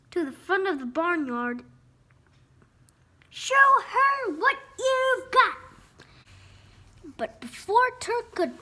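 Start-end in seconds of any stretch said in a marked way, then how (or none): 3.3-5.6 high, loud, slow urging. A narrator's reading. Parents push Turk to perform, loud urging call. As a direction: call it out word by word.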